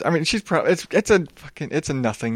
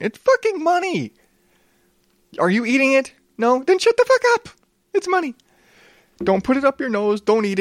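exasperated rant; 9 semitones higher, faster than usual. Real, not acted. Ranting at complaining vegans.

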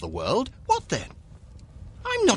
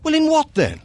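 incredulous; 14 semitones higher, 4 dB louder, faster than usual. Acted. Quarrel over broken engagement.

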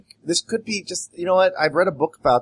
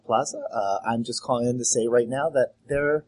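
normal talking voice

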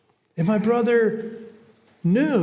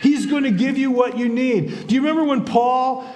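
higher, emphatic preaching; impassioned preaching; 4 semitones higher than usual. Real, not acted. Preacher promising God's help in that fight, impassioned. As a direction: drive the promise home to the listeners.

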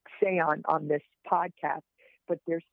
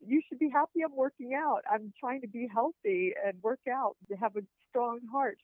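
higher-pitched mock-indignant retort; playful protest; 7 semitones higher than usual. Patient telling the doctor off before surgery, playful protest. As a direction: plead your case in a high, lively voice.

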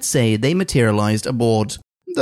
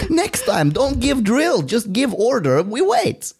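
high-pitched mock Russian scolding; comic Russian accent, pitched way up; 10 semitones higher than usual. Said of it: "this is my best Russian accent".

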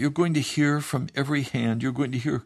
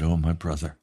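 much lower, gentle counsel; tender pastoral reply; 4 semitones lower than usual. Retold or imitated. Pastor gently counselling the angry young man, low and tender. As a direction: soothe him like a caring pastor. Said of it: "I said".

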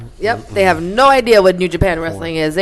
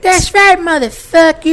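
Cornette impression; 11 semitones higher, 5 dB louder, slower than usual.

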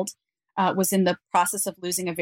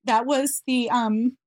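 higher, halting voice close to tears; tearful; 4 semitones higher than usual. Real, not acted. Mother explaining to interviewer why this part makes her cry, tearful. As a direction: explain it while recovering from crying. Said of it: "I still cry when I tell that part".

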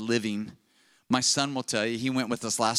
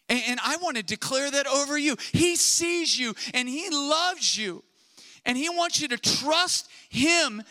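preaching declaration; 12 semitones higher than usual. Acted. Declaring blessing over congregation.

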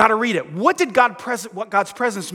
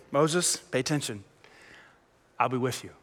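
soft, lower and hushed; God reassuring; 9 semitones lower, 10 dB quieter than usual. Acted. God promising Moses his presence, soft and hushed. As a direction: reassure him gently as God would.

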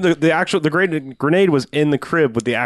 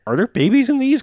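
incredulous; 12 semitones higher than usual. Retold or imitated. Incredulous quote about thrown cribs.

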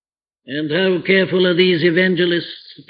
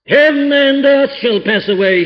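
fervent proclamation; 8 semitones higher, 4 dB louder than usual. Acted. Quoting Jesus, raised and fervent.

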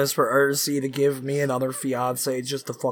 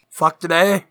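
toast; 4 semitones higher, 7 dB louder than usual. Acted. Toast, raising the glass.

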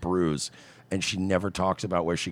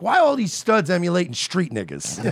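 incredulous quote; 9 semitones higher, 4 dB louder than usual. Retold or imitated. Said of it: "The way he said it killed me".

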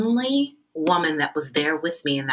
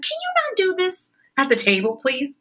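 high-pitched, beseeching imitation; mock pleading objection; 13 semitones higher than usual. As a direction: beg them to stop, pitched way up.

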